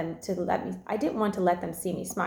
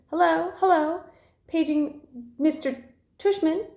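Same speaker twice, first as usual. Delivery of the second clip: high put-on old lady announcing over a loudspeaker; dad pages the principal in a high, fake old lady voice; 9 semitones higher, 3 dB louder than usual. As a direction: mimic a fussy old lady paging someone. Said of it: "fake high old lady voice".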